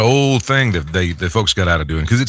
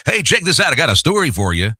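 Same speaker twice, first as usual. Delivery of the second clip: high, hammed-up radio hook; announcer-style pitch; 6 semitones higher than usual. Shown as a demonstration. Radio coach showing how not to introduce stories, hyped announcer voice. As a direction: sell the story like an impersonal broadcaster. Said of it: "you don't go".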